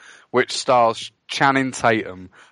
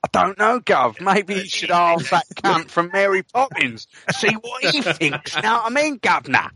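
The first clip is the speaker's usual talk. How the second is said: hammed-up cockney, pitch jumping high; horrible British accent; 4 semitones higher than usual. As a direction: do a terrible Mary Poppins cockney. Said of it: "doing a horrible British accent".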